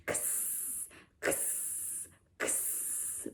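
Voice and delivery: kss as a breathy unvoiced hiss; X sound for kids; whispered X sound. Shown as a demonstration. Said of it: "which is the sound of the letter X".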